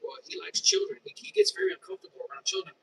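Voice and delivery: high-pitched mock gossip. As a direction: mimic the customers mocking him to their kids.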